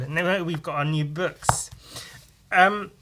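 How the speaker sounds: normal talking voice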